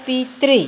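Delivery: पितृ sounded out as pi-tri, ending on री; pronunciation demo. Shown as a demonstration. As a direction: model पितृ in two clear parts, pi and tri. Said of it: "तो इसका भी उच्चारण होता है".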